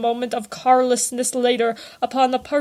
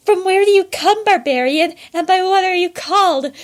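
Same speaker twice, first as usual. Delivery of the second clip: higher, breathless questions from the wench; gasping wench; 8 semitones higher, 5 dB louder than usual. A narrator's reading. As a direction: gasp the questions out, caught up in the moment. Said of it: "gasped the complying wench".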